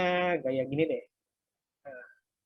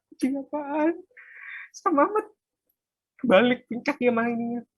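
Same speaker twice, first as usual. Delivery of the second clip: amused disbelief; 7 semitones higher, 9 dB louder than usual. Real, not acted. Amused by the silly cat output.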